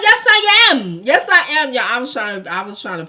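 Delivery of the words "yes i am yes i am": loud, high-pitched retort; defiant comeback. Retold or imitated. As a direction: act out insisting right back at him, loudly. Retold defiant comeback to boyfriend.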